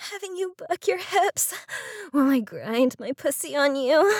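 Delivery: gasping climb toward climax; breathless moaning. Acted. Nearing climax in intimate scene.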